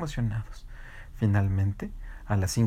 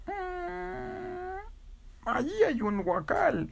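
very high hum then slow high answer; high hen idea; 5 dB quieter, much slower than usual. Acted. Teacher hen suggesting a crate, in a very high slow voice.

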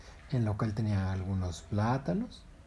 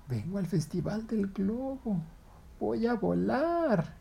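high, slow dreamy excitement; excited monkey; 10 semitones higher, slower than usual. Acted. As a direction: dream aloud about flying. Monkey excited for balloon festival.

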